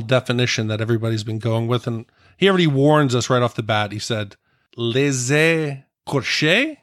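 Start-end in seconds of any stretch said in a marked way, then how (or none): 4.8-6.8 put-on French accent. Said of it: "we should try it with a French accent".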